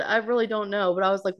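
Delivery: ordinary talk, nothing marked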